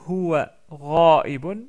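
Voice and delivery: "huwa ra'ibun" loud and slow, each syllable clear; Arabic example. Shown as a demonstration. Teacher reading an Arabic example sentence to the class. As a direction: read "huwa ra'ibun" out carefully. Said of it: "regardez ce mot-là".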